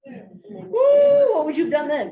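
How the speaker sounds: loud whooping challenge to the room; excited shout. Real, not acted. Fires up the audience.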